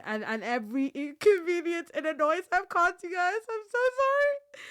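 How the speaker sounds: high, tearful apology; heartfelt apology while crying. Real, not acted. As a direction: apologize through tears. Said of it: "everybody's crying on the microphone".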